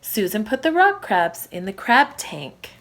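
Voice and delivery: ordinary delivery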